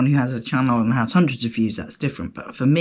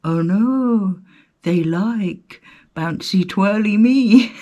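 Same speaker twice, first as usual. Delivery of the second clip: higher, slower mock dismay, drawing out twirly me; dismayed realisation; 6 semitones higher, 4 dB louder, slower than usual. Telling listeners how she realised viewers like bouncy her, mock dismay. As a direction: play up the horror of the discovery. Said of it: "I went".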